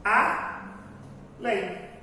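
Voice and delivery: ALÉM pronounced as A, pause, LÉM; two slow, separate beats: A, then LÉM in ALÉM; split into syllables. Shown as a demonstration. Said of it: "separação se dá por meio da pronúncia".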